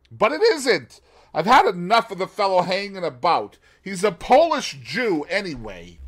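John bursting out in jealous anger; angry. A narrator's reading. Husband shouting at his wife about her friend, angry.

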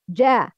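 "ja" with a hard voiced j in place of sh; voiced jim error. Shown as a demonstration. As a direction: turn the sh into a buzzing "ja". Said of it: "it will be".

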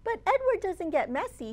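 ordinary delivery, nothing marked

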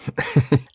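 short amused laugh; a quick little laugh; light laugh. Acted. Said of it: "laughed Dorothy".

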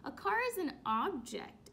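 normal talking voice

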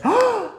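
high, sharp gasp of wonder; hammed-up storyteller gasp; amazed gasp. Shown as a demonstration. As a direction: gasp as if astonished for a child. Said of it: "Y dices".

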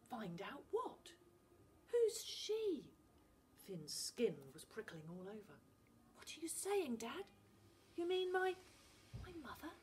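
shocked son asking; stunned boy. Acted. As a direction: dare to ask about his mother. Finn asks about mother.

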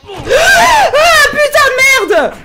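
screaming in fright, very loud and high; real jump-scare scream; terrified scream. Real, not acted. Jump scare in horror game.

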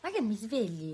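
soft fake-sleepy voice; soft mock-sleepy talk; pretend sleepy. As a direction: play the tired auntie.

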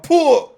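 loud, high explosive "puh"; exasperated outburst. Real, not acted. Player reacts to a missed chance, exasperated. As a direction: burst out with a frustrated puff.

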